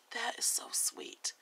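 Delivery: soft, slow, touched whisper; hushed and slow, savouring it. Real, not acted. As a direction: breathe it out as if moved. Moved by the sweet quote.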